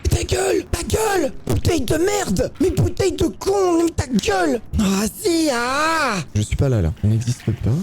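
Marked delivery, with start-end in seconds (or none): 0.1-6.2 very high, yelled insults; raging at a noisy bottle that gave him away; furious shriek. Real, not acted. Crie sur une bouteille bruyante. (hurlement furieux, très aigu)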